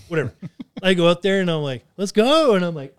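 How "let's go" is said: loud, high call-out; fight challenge. Retold or imitated. Man calling out a gang outside a bar, loud challenge. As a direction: holler it like squaring up for a fight.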